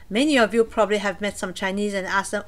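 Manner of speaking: ordinary delivery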